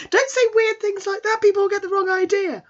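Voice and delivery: loud, very high mock protest; hammed-up squeaky telling-off; flustered scolding. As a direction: squeal in embarrassed alarm. Embarrassed boy scolds his admirer.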